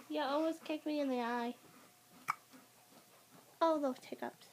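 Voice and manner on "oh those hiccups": ordinary talk, nothing marked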